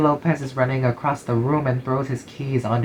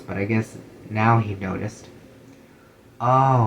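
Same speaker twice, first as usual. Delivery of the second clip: usual speaking voice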